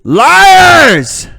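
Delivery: sudden high, loud cry of Liars!; mock-indignant Aussie outburst; outraged shout. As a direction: yell it like you've been betrayed. Outraged at Foster's ad claim.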